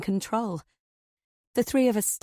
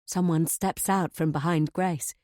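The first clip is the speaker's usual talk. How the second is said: ordinary delivery, nothing marked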